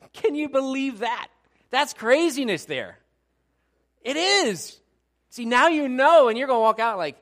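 high, loud incredulous exclaiming; full-voiced, excited disbelief; shouted amazement. Real, not acted. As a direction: shout it out to the whole church.

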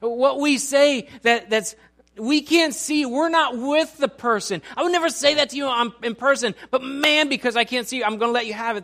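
high, loud sermon delivery; forceful preaching. Real, not acted.

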